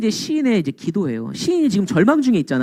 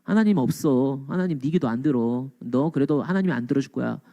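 low, soft dismissive jibe; understated mocking, dropped in pitch; mocking scoffer; 7 semitones lower, 6 dB quieter than usual. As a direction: imitate a scoffer quietly putting down faith. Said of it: "신앙을 비웃는 인간들 있잖아".

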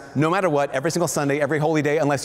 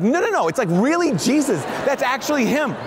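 excited insistence; 7 semitones higher than usual. Retold or imitated. Young [name] insisting to his family, excited and high.

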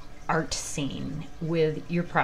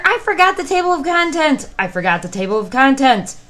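loud alarmed exclamation; louder panicked outburst; frantic cry; 8 semitones higher, 10 dB louder than usual. Acted. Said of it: "cried Jack the narrator".

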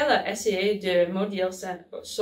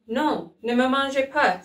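higher, scared plea to be spared; frightened cry; 5 semitones higher than usual. A narrator's reading. Sam crying out in fear to the elf. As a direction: cry out in fear, begging for mercy. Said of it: "s'écria Sam".

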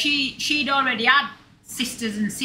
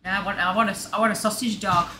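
ordinary delivery

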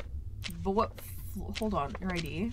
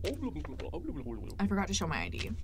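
quiet sheepish visitor voice; 4 semitones lower, 7 dB quieter than usual. Pretend. Voicing the visitor.

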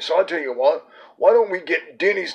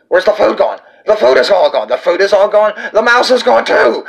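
alarmed outcry; 4 semitones higher, 7 dB louder than usual. Acted. Host alarmed food vanished.

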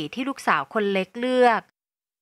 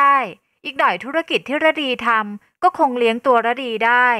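loud, raised-pitch defiance easing toward the end; defiant retort; 3 semitones higher, 5 dB louder than usual. Acted. Daughter arguing with her father over money and girlfriend, defiant. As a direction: stand up to your father, loudly.

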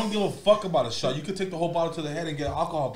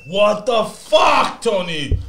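slow, high, loud yell at Tony; pained shout; 4 semitones higher, 8 dB louder, much slower than usual. Real, not acted. Guest yelling at host over the chip. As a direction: bellow it out in burning pain.

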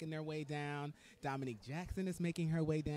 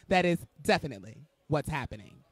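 louder and fast, laughing confidence; emphatic; 10 dB louder, faster than usual. Real, not acted. Host confirming her voice will go loud, emphatic. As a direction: punch it out gleefully.

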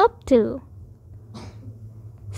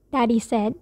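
plain reading voice

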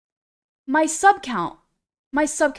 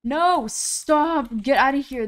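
playful yell; 3 semitones higher, 5 dB louder, slower than usual. Real, not acted. Streamer fending off game player.

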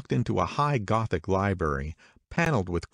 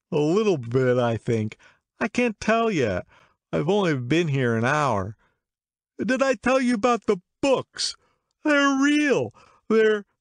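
put-on excited drunk man; 11 semitones higher, 5 dB louder than usual. A narrator's reading. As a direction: prattle proudly like a drunk. Drunk man repeats himself.

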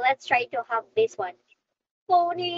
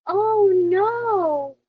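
mock dismay; 4 semitones higher, 5 dB louder than usual. Mock alarm in English lesson.

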